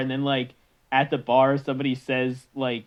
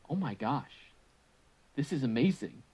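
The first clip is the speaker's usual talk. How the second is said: hushed awe; 8 dB quieter than usual. Retold or imitated. Daughters taste the hot dog.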